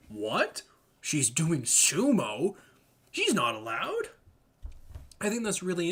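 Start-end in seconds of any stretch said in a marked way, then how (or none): 0.1-4.1 slow, stunned disbelief; drawn-out surprised double take; shocked reader. Retold or imitated. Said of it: "a Japanese mindset, you're like".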